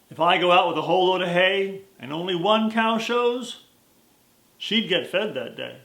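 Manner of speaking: put-on slow folksy old cowboy; lightly voiced old cowhand, unhurried; cowboy drawl. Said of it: "his Western drawl".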